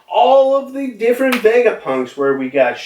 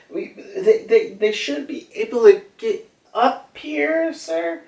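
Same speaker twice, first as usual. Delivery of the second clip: much higher put-on voice, rising to a squeak on sir; voicing Pythagoras; 12 semitones higher than usual. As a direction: fret up at the boss. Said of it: "Pythagoras being like".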